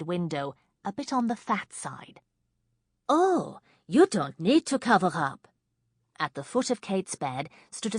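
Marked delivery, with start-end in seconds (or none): 3.1-5.5 put-on louder, teasing thick French accent. A narrator's reading. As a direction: purr it in heavy French. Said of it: "in a French accent as thick as nightclub smoke".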